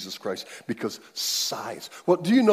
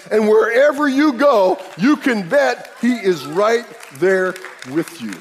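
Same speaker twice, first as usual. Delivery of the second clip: loud, higher climactic sermon voice; preaching at full force; 8 semitones higher, 8 dB louder than usual. Real, not acted. Pastor declaring God dwells in you, full force. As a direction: build the crescendo to the congregation.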